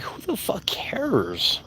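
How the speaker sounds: slow, breathy whisper of contempt; whispered who cares; scornful whisper. Acted. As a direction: hiss it out like a dismissive aside. Dismissing fans, aside.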